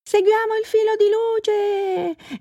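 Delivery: very high, drawn-out rallying call; exclaiming. A narrator's reading. Reindeer urging the others to follow, exclaiming. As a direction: sing out the command high and long. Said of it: "esclamò la renna cometa".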